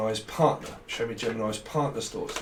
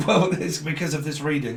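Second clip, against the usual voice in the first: annoyed laugh; 4 semitones higher, 6 dB louder than usual. Real, not acted. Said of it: "I'm getting so annoyed".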